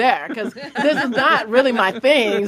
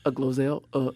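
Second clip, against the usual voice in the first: lower, softer awkward politeness; president's polite voice; 7 semitones lower, 4 dB quieter than usual. Retold or imitated. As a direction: gently ask to be released. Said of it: "he's like".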